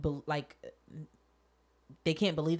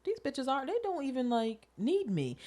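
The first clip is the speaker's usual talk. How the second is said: mock petty complaint; 8 semitones higher than usual. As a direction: whine it up high like a diva slighted. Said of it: "And then I was like".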